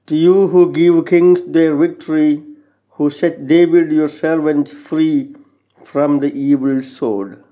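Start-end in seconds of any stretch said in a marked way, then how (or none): none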